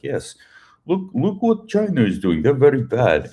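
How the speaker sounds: high-pitched mock Russian accent. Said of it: "sneak in some Russian accent".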